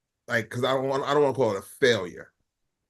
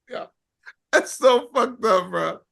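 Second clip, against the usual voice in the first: laughing; 9 semitones higher than usual. Real, not acted. Laughing through words.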